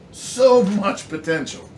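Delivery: rising frustration on the echo; heated repeat. Real, not acted. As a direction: hit so much potential harder the second time. Repeats it more forcefully.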